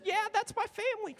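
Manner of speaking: high, quick squeak; lightly mocked reply. Echoing an audience member's joke, in a high mock voice. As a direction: toss it off in a high little voice.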